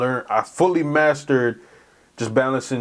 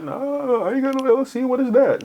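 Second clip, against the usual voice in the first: much higher, mock-naive kid voice; clueless kid; 12 semitones higher than usual. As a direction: play your confused teenage self, pitched way up. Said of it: "so I was like".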